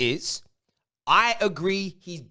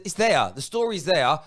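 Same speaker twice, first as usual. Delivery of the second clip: usual speaking voice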